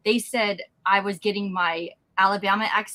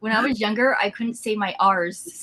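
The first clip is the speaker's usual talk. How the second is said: usual speaking voice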